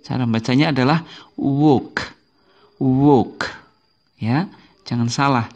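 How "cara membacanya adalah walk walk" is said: walk as wok, silent L; correct pronunciation. Shown as a demonstration. Teacher modeling walk without the L. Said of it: "L-nya tidak usah dibaca".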